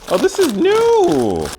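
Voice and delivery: high, loud squeal of delight; delighted high-pitched exclamation; excited discovery. Real, not acted. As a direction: stretch it out, loud and thrilled. Reacting to a new product launch.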